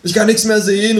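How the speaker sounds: high, loud call of being dazzled. Acted. Narrator calls out to the waiter, dazzled. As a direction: call out blinded by the flashlight. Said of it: "rufe ich".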